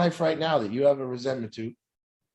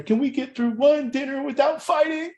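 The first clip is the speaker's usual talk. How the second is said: much higher, straining mom voice; exasperated mom; 11 semitones higher than usual. Acted. Mom pleading for one peaceful dinner, exasperated and shrill-pitched. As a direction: act a fed-up mom raising her pitch.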